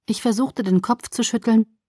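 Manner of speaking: plain reading voice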